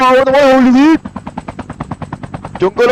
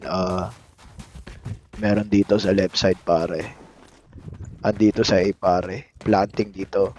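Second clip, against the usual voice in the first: much lower and softer, a mock deep gamer voice; deep-voiced male streamer; 12 semitones lower, 10 dB quieter, slower than usual. Streamer mimicking deep-voiced male streamers giving callouts, much lower and softer. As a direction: put on the low voice of a cool male streamer. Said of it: "deep voice na lalaki".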